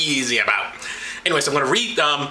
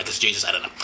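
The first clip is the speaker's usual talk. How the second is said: ordinary delivery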